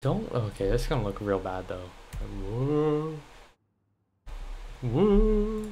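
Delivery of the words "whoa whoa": surprised whoa. Real, not acted. Reacting to the game.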